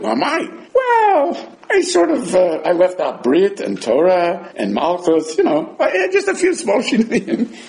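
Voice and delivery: squirm while owning up with a giggle; sheepish excuse. Acted. Sheepish student admits omissions.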